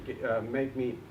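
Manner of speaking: ordinary talk, nothing marked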